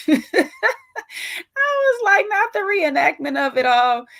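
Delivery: high, laughing through the words. Real, not acted. Host cracking up over the reenactment, laughing. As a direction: crack up while getting the line out.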